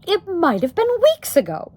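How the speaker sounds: high, loud, alarmed realisation; exclaiming. A narrator's reading. Wife realises time has passed, exclaiming. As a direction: voice leaps up in alarm. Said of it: "exclaimed Homley".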